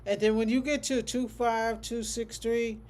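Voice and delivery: ordinary talk, nothing marked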